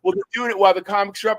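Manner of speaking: fast, high, heated protest; high-pitched imitation of his quick rant; retold angry scolding. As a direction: fire back the complaint in a rush. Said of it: "he started screaming at me".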